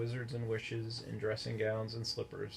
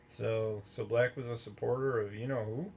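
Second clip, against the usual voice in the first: ordinary delivery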